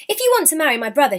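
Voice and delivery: fast and high; brisk. A narrator's reading. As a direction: rattle it off sharply. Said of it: "she replied".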